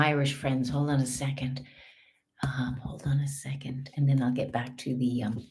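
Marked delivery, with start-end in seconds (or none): 2.4-3.9 whisper. Real, not acted.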